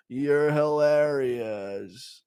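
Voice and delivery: very slow, drawn-out flirty drawl; drunk Jon Jones. Retold or imitated. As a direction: slur it like he's had a few.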